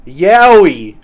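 ordinary talk

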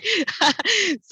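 bright laugh. Real, not acted. Laughs at her own joke.

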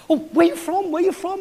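high, eager rush of questions; all excited. Retold or imitated. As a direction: act out excitedly greeting a fellow countryman. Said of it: "I get all excited".

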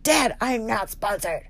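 put-on slow, drawn-out teenage protest to dad; mock teenager. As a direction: complain like an embarrassed teen. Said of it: "like, a teenager".

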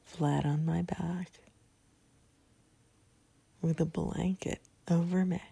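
calm, spaced-out phrases with long pauses; guided meditation. Real, not acted. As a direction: lead them gently into relaxation, a few words at a time. Leads the sleep meditation.

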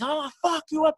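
very high-pitched imitation of a raging opponent; retold threat, squeezed way up in pitch; mock losing it. Opponent losing it at the storyteller, mock rage. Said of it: "I just hear Johnny losing it".

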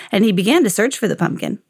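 ordinary delivery, nothing marked

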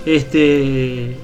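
usual speaking voice

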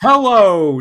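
loud, high robotic bellow; Metatron robot voice. Acted. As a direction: shout hello down the line like a robot. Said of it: "robot voice just being like".

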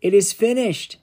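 high, loud triumphant cry; a great ringing shout of victory. Acted. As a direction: call it out to the whole world. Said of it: "shouted out in a loud voice".

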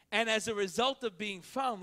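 ordinary talk

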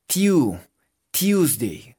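"Tuesday" as "tiús-dei"; posh-style "Tuesday". Shown as a demonstration. Teacher modelling Tuesday, posh-style "tiu". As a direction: glide from t into "yu" in "tu, Tuesday". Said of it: "como si fuera bien gomelito".

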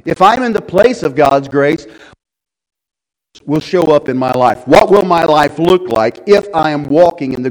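loud, high exhortation to the church; full-force preaching. Acted. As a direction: drive the verse home to the congregation.

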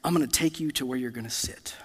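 soft, low near-whisper; hushed friend. Retold or imitated. Childhood friend leading him by the hand, hushed. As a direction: quote the kind friend in a gentle hush. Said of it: "took me by the hand and he said".

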